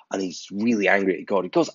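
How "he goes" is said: ordinary delivery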